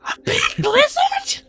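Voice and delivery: put-on very high, slow, wide-eyed child voice; a small kid who can't believe the news; Silly amazed.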